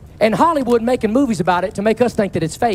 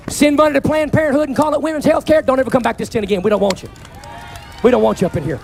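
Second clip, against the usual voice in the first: full-force preaching; 3 semitones higher than usual. Real, not acted. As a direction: preach it hard to the crowd.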